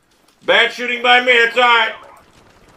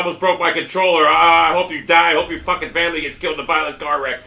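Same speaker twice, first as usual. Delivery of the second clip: ordinary talk, nothing marked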